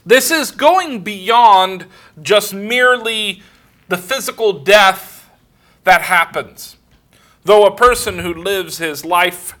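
loud, high fervent sermon voice; impassioned pulpit delivery; full-force preaching. Acted. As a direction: preach it out, swelling with conviction. Preaching grace and death.

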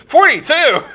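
high, loud mock disbelief; incredulous. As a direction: squawk it in astonishment. Coworker shocked at age.

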